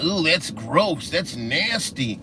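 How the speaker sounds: slow, drawn-out mock disgust; mock disgusted people. Mocking people who find breast milk gross, slow disgust. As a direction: mimic the squeamish crowd lightly. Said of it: "People think of it now".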